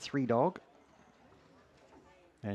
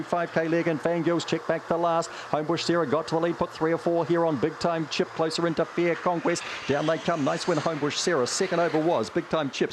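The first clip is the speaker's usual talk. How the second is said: fast live race commentary; race caller; 8 semitones higher, 5 dB louder than usual. Acted. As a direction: rattle off the field like a track caller. Calls the dog race live.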